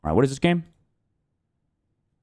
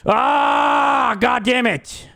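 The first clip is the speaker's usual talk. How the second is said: sharp annoyed yell; frustrated outburst; 9 semitones higher, much slower than usual. Real, not acted. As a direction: blurt it out in frustration. Missed option, frustrated outburst.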